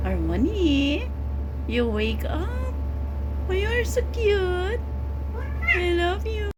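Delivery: high cooing wake-up. Real, not acted. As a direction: coo brightly at the cat. Cooing at a waking cat.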